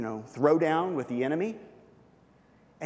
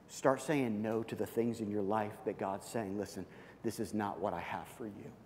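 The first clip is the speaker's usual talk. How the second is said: softer, lower preaching; 6 semitones lower, 9 dB quieter than usual. Acted. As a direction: drop low and much softer. Quiet intimate sermon close.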